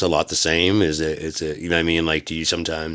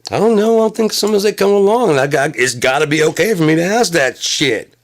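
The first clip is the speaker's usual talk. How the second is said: loud, high, insistent; high shouting; 11 semitones higher, 5 dB louder than usual. Acted. Comic insists loudly that he gets to ask, high. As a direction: demand it loudly, high and exasperated.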